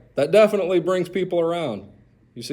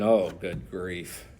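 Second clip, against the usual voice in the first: much lower, softer and slow, fed up; grumbling; 11 semitones lower, 7 dB quieter, slower than usual. Retold or imitated. Isaac grumbling to himself at the visitors, low and slow. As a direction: sigh it out under your breath, annoyed. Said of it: "I'm thinking".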